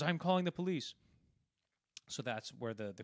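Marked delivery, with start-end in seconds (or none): 0.0-0.9 higher, louder angry warning; angry threat. Retold or imitated. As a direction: threaten him with the police. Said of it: "He goes".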